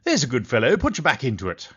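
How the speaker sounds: a lightly done mock British accent, cheery and a touch loud; terrible British accent. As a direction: play a bad British gent cheering on a wrestler in a British accent. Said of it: "That's my terrible British accent".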